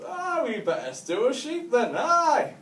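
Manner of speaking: put-on high-pitched, excited drunk agreement; hammed-up drunken cheer, drawn out at the end; drunken enthusiasm. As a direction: leap at the daft idea like a keen drunk. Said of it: "these two were so pissed off their tits".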